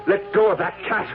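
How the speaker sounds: high, raging order; furious demand. Acted. Phil ordering Sylvia to give up the cat.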